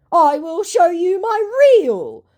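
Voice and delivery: high, loud, over-punctuated insistence; mock exclamation. Scammer insisting to the doubting host, mock emphatic. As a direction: blare it out in put-on desperation. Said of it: "sending an insane amount of exclamation points".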